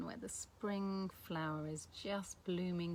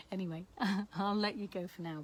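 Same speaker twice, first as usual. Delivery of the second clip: usual speaking voice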